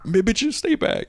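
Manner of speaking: high, laughing brush-off; giggling mock scold; laughing mock annoyance. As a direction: toss it off with a laugh, half mocking. Voicing annoyed character, laughing.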